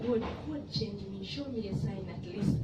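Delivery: soft inward plea to God; quiet heartfelt prayer to God; hushed prayer. Retold or imitated. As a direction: pray under your breath. Recalled silent prayer in heart.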